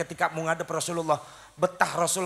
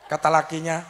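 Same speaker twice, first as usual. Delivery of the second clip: normal talking voice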